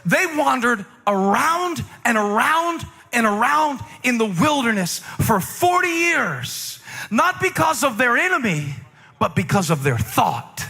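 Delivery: high, slow, ringing sermon climax; full-force preaching. Acted. Pastor preaching to the congregation, high and slow.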